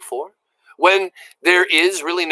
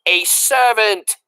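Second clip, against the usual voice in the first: shouted slowly; 6 semitones higher, slower than usual. A narrator's reading. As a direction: spell it out to a deaf girl. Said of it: "shouted the soldier".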